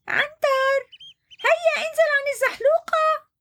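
high, calling giraffe voice. Acted. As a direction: call up to him on the slide. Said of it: "صاحت رندا". (رندا تنادي عنتر لينزل)

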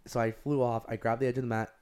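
usual speaking voice

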